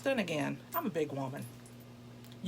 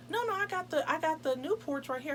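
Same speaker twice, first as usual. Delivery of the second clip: much higher, insisting; eager insistence; 9 semitones higher than usual. Retold or imitated. Date insisting on his cigarettes in a higher voice. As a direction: push the offer brightly up high. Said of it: "He said".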